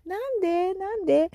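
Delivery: high-pitched mock wail; playful lament. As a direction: whine it in playful regret. Laments eating malasada only once.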